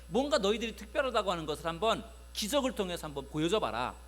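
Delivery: ordinary talk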